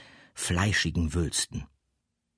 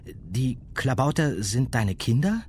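put-on higher young girl voice; 7 semitones higher, 3 dB louder than usual. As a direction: ask as a curious girl. Girl character asks.

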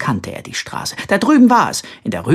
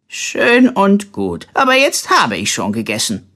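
put-on old lady; 4 semitones higher than usual. A narrator's reading. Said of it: "erklärte die alte Dame".

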